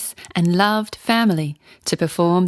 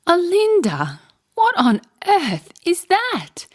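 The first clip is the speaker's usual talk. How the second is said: high, giggly disbelief; giggling; 11 semitones higher, slower than usual. Acted. Sister laughing at Olinda's strange song, high and giggly. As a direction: squeal it through giggles at the sister. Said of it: "she cried between giggles".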